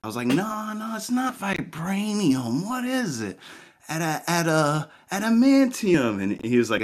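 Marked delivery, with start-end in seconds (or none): 0.2-3.4 high, drawn-out mock searching; feigned ignorance. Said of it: "I was trying to hide". 3.9-6.2 high, halting fake recall; feigned groping for a word. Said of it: "I was trying to hide".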